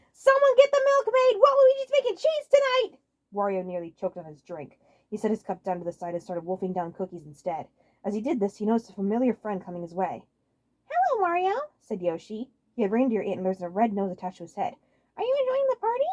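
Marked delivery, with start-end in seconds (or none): 0.1-3.0 very high, loud party yell; yelling at the top of his lungs. Acted. Waluigi yelling to the party crowd, very high and loud. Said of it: "he yelled at the top of his lungs". 10.9-16.1 high friendly cartoon voice; Yoshi's voice. Yoshi greeting Wario at the party, high cartoon voice.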